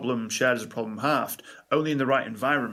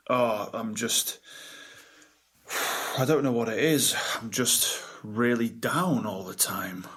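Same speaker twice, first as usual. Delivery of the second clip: imitating a client's slow, deflated drawl; slow, low and down. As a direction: let the words drag out heavily, "down" sinking low. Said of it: "Why are you talking so slowly?".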